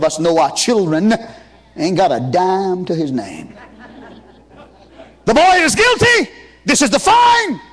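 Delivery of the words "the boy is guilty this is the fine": loud, high booming verdict from the father; shouted high father's pronouncement; stern father's verdict. Acted. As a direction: proclaim it like a stern judge of a father. Father pronounces son's fine.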